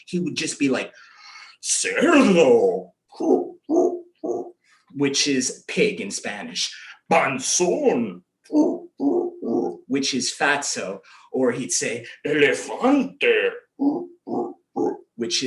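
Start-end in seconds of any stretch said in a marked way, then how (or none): 1.6-2.9 loud, drawn-out taunt; higher, louder teasing call; mocking name-calling. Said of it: "was to make fun of me". 7.1-8.2 drawn-out jeering taunt; put-on bully's call; mocking name-calling. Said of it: "was to make fun of me". 12.3-13.5 slow, loud taunt; imitated teasing call; mocking name-calling. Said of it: "was to make fun of me".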